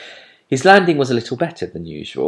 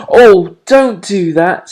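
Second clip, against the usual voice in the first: pained plea; 11 semitones higher, 12 dB louder, slower than usual. A narrator's reading. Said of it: "putting her hands over her ears".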